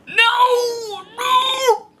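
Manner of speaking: hammed-up panic; mock horrified cry. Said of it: "I'm hyperventilating".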